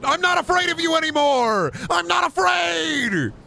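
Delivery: put-on high, loud shrieking kid defiance; Home Alone kid shout. Kid yelling at an intruder, high shrieking defiance. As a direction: shriek it like a kid fighting off burglars. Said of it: "I just watched Home Alone".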